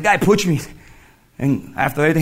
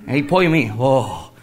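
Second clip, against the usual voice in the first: normal talking voice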